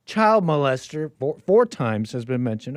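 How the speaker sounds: ordinary talk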